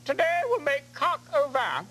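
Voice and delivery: put-on high, slow, fluty Julia Child voice. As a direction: announce the recipe like Julia Child. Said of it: "a little bit Julia Childs".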